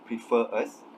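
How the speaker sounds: "prefer us" with no r before "us"; "prefer us" without inserting an r sound; no linking r. Shown as a demonstration. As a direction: keep "prefer us" as two separate words, r dropped. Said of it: "insert the r sound".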